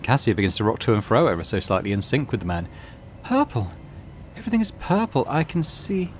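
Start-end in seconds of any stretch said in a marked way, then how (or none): 3.2-6.1 high, dreamy voice, far above normal; airy wonder, as if in a trance; entranced young seer. Acted. Entranced Cassia rocking in sync.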